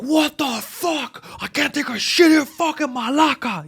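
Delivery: high-pitched, drawn-out outraged holler; imitated yelling. Angry man bursting out of the bathroom, high mock-outraged yelling. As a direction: holler it like a furious man, hammed-up. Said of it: "He's yelling".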